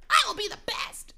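high-pitched battle boast; boasting rival trainer. Acted. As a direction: declare it like a cartoon rival.

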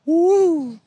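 high, loud woo; a sudden cheer of delight; excited whoop. Real, not acted. Chaotic race excitement.

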